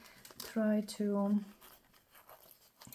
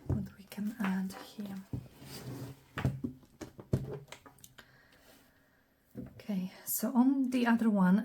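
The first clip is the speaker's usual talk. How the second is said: whispering; faster than usual. Acted. Whispered crafting commentary.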